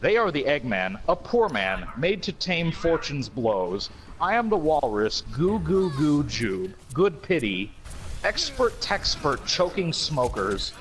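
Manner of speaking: slow, solemn recital of the lyrics; dramatic lyric reading. Acted. Reading Walrus lyrics aloud to co-hosts, slow and mock-solemn. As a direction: read it like grave poetry, taking your time.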